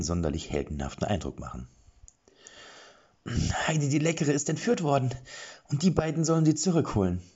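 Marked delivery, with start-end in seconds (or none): 3.7-7.2 a higher, brisk guard voice; lightly voiced guard. A narrator's reading. Guard tells the dwarf about the kidnapping, higher brisk voice. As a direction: report it quickly and matter-of-fact.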